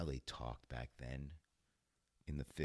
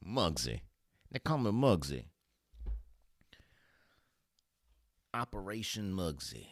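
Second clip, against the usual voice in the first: put-on loud, pitched-up old-movie mobster; mock gangster; 6 semitones higher, 8 dB louder than usual. Gangster introducing himself as Muggsy, mock mobster voice. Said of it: "I'm a gangster".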